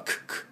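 short "ク" as a lifted k sound; brief k on "ク"; crisp k. Shown as a demonstration. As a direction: pop "ク" like a bare English k. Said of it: "持ち上げる音".

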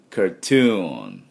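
slow "カートゥーン" ending on a held n; careful drawn-out English "カートゥーン". Shown as a demonstration. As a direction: stretch "トゥーン" in "カートゥーン". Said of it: "こういう音になります".

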